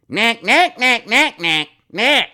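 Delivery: high, loud nasal-free "knack knack" taunt; mocking cackle. Brushing off Brian's comment with a loud high taunt. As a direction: squawk it out like a mocking bird.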